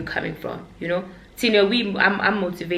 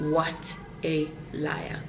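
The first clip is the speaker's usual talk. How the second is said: slow, softer, in an altered voice; mock self-accusation; 6 dB quieter, much slower than usual. Shown as a demonstration. Vlogger calling herself out for lying, slow and mocking. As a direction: drag it out, teasing yourself. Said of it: "Oh, what a liar".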